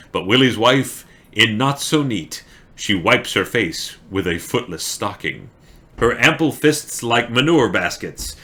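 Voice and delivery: lively, emphatic verse reading; poem recitation. Acted. Streamer reading Burns poem for story time, emphatic recitation. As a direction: punch each line, easing off into the refrain. Reads requested poem aloud.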